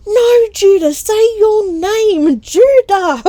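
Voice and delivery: put-on very high, loud, slow child's plea; a boy losing patience with his baby brother; squeaky shouting little boy; exasperated big brother. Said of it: "He's going".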